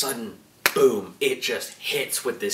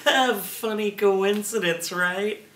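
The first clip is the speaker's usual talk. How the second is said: nervous laugh; 7 semitones higher, slower than usual. Retold or imitated.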